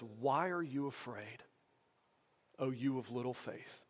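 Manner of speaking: lightly voiced Jesus, calm and unhurried; gentle rebuke. Jesus to his panicking disciples, softly and gently rebuking. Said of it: "a gentle rebuke".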